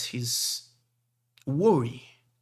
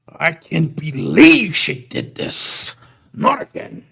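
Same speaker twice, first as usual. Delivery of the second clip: exasperated; 4 semitones higher, 13 dB louder, slower than usual. Acted. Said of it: "the big man just goes".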